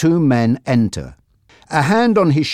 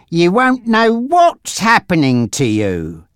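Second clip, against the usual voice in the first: higher, slow threat; scheming gangster Frankie; 7 semitones higher than usual. Acted. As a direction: draw out the menace for the captive.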